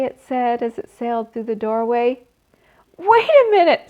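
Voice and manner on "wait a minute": high, loud frantic scream. Acted. Wilbur screaming at the spider to wait. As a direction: pig screaming after a departing spider. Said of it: "screamed Wilbur".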